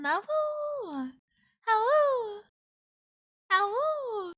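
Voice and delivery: high playful awoo howls; howling like a wolf pup. Pretend.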